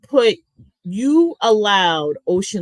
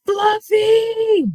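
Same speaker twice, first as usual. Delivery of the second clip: calling out; 13 semitones higher than usual. Real, not acted. Calling out a stream name.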